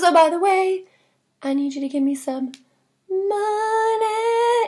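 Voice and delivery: casual, then money sung out high; sly king. Retold or imitated. Teacher as king, sweetly singing his demand for money. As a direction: slip in the demand, sing the last word. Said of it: "the king says".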